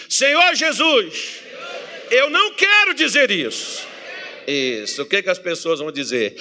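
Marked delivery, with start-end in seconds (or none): 0.1-3.9 loud, higher faith-filled shout; crying out to the Lord; with more faith. Shown as a demonstration. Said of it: "Bora lá, diga assim".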